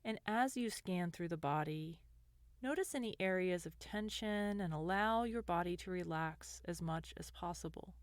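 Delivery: gentle guided meditation voice, lingering between phrases. Acted. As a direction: ease the listener into calm, unhurried.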